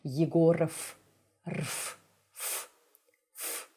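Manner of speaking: "Yegorov" ending in a hissed f, then "rf", "f" alone; unvoiced final consonant. Shown as a demonstration. Teacher reminding learners, last consonant turned to voiceless f. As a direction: devoice the end of "Yegorov" to f. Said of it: "unvoicing of the last consonant".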